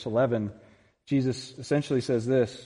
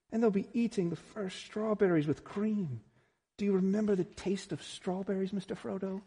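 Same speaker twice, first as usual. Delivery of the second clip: lightly voiced Sam coaxing; 8 semitones higher than usual. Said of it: "He says".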